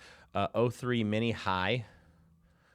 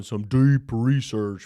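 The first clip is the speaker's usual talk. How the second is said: louder, slow announcer touch; trailer voice; 11 dB louder, slower than usual. Acted. Catchphrase slipped in.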